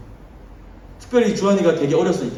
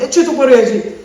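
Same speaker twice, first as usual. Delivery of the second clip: mock scolding; 8 semitones higher, 7 dB louder than usual. God scolding imagined.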